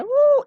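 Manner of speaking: very high, thin ooh squeak; high squeal. Shown as a demonstration. Teacher demonstrating a very high, thin ऊ squeal. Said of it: "आवाज पतला हो रहा होता है".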